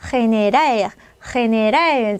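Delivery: general said "he-ne-RAL", g as a rough h; Spanish pronunciation. Shown as a demonstration. Teacher contrasting it with English, general as Spanish "heneral". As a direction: model general slowly in Spanish, starting on h. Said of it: "스페인어 발음 그대로 하면".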